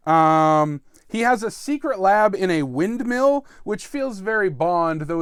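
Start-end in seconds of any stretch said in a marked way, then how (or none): none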